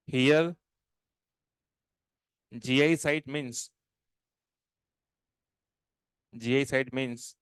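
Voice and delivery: slow, spaced-out words; slow dictation. Shown as a demonstration. Teacher dictating definition to class. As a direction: spell it out slowly for notes.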